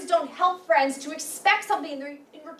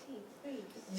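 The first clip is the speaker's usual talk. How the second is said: soft plea; 7 semitones lower, 16 dB quieter than usual. Acted. Pleading with the hurt friend.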